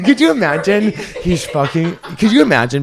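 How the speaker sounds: high laughing question. Real, not acted. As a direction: laugh while asking, amused. Laughs at the idea.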